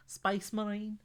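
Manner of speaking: a quick try at a Scouse accent. Shown as a demonstration. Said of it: "How would you say Space Marine in a Scouse accent".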